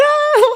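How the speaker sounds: a sudden high, loud hammed-up shriek; mock scream. Mocking the frightened people he hears screaming, hammed-up shriek. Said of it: "now you hear them screaming".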